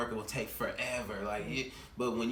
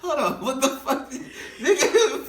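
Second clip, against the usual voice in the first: laughing disbelief; 5 semitones higher, 10 dB louder than usual. Real, not acted.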